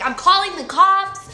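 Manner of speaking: high, loud yelled warning; retold scream at the stranger; screamed threat. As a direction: threaten him at the top of her voice. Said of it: "I'm still screaming at him".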